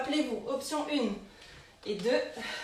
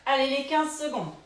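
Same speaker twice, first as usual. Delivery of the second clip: usual speaking voice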